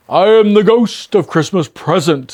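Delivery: ghost. A narrator's reading. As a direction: proclaim yourself grandly. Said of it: "said the figure".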